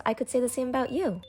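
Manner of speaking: ordinary delivery, nothing marked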